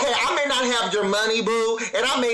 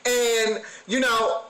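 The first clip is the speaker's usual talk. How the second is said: normal talking voice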